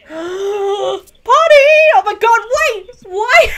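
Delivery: sudden loud high exclamations of shock; startled shout. Real, not acted. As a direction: yelp out in disbelief at the jump. Shocked by a sudden scene change.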